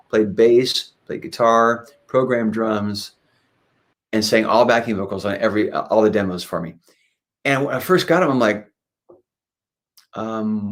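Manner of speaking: ordinary delivery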